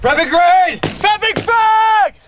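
loud drawn-out callout; grenade warning yell. Real, not acted. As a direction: call it slowly so everyone hears. Live grenade drill callouts.

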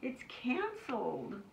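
high, slow wail. A narrator's reading. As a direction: stretch it into a sorry wail. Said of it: "wailed Ava's mother".

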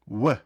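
В said as a w, lips rounded and open, not pressed; w without closed lips. Shown as a demonstration. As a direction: blow the В out without closing the lips. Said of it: "Так би хотіли подути".

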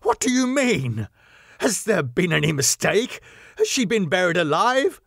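pressing in rising horror; frantic cry. A narrator's reading. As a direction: Arthur demanding answers in dread. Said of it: "he cried".

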